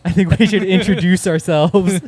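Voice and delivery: loud announcer voice. Shown as a demonstration. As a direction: announce it like a radio host. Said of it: "I'm gonna get a lisp".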